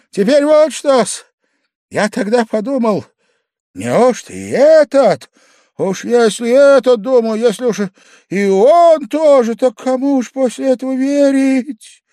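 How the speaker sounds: high, loud impassioned outpouring; drunken frenzy. A narrator's reading. Drunk guest asking whom to trust, frenzied and loud. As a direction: cry it out, stretching key phrases. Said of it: "в пьяном исступлении крикнул Павел Павлович".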